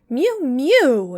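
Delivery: high, loud mewing cat; a cat interrupting with a loud mew; cat's mew. A narrator's reading. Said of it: "interrupted Simpkin".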